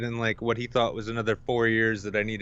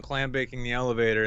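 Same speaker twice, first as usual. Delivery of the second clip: normal talking voice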